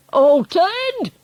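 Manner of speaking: high, outraged shout on "Altered"; incredulous shout. A narrator's reading. Father echoes the bad news, incredulous shout. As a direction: bellow it back in disbelief. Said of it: "shouted Mister Jenkins".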